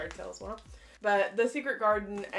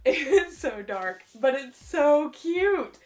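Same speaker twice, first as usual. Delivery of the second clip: laughing, higher and louder; real amusement; 5 semitones higher, 7 dB louder than usual. Real, not acted. Showing off sad unicorn artwork, amused and laughing. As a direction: gush through a laugh, drawing out the words.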